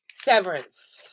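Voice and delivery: usual speaking voice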